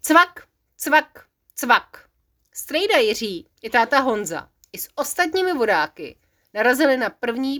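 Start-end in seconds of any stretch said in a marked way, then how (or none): 0.0-2.0 clicking sound effect. Acted. Narrator imitating boat capsizing.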